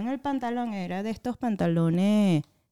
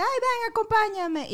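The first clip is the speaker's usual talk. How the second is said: high, sing-song coaxing; light mock sweet invite; 13 semitones higher than usual. The boy she liked inviting her along: high sing-song coax.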